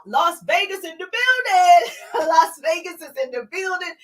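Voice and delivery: high, loud hype call; excited roll-call holler; shout-out. Real, not acted. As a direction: hail the arrivals like a hype host. Host hails viewers joining live.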